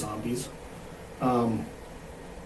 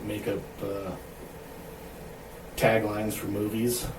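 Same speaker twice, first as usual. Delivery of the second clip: usual speaking voice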